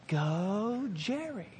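soft, drawn-out imitation of cheering; hushed mock cheer. Preacher imitates God cheering him on, hushed mock cheer. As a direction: cheer someone on under your breath, slowly. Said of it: "you can hear him cheering us on".

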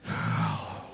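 long, soft put-on sigh; mock suffering sigh. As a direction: sigh like a pretend martyr. Acted suffering faster sighs.

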